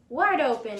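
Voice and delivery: high, loud command; wife yelling at the fox to open wide; yelled. A narrator's reading. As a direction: shout it up at the patient. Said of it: "yelled his wife".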